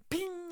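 high-pitched ringing "ping"; imitated star ping. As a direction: make a high cartoon twinkle noise. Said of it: "First it goes".

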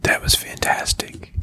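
voiceless whisper; whispered reaction. Real, not acted. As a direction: whisper it under the breath. Hushed reaction after co-host's long monologue.

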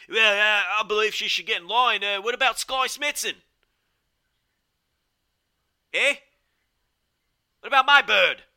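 put-on high, loud British accent; a hammed-up posh British bloke; British commentator impression. Host redoing the commentator in a British accent. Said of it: "There's your, uh, Lord Gideon Gray impression".

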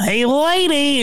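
put-on squeaky goofy man-child call; Jerry Lewis. Host mimicking the famous comic's voice, high goofy yelp. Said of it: "do that voice, that's Jerry Lewis".

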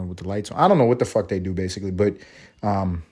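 normal talking voice

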